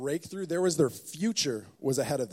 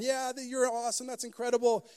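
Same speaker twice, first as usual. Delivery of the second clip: cheering crowd; 7 semitones higher than usual. Retold or imitated.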